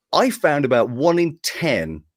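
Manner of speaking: ordinary delivery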